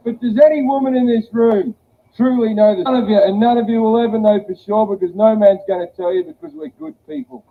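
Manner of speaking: ordinary talk, nothing marked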